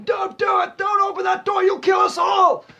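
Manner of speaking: high, loud desperate yelling; imitated shout. Retelling the trailer, John Goodman yelling at the door. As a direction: shout the warning like a panicked man at a door. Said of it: "John Goodman's on the door going".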